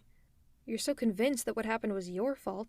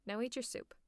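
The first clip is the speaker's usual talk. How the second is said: ordinary talk, nothing marked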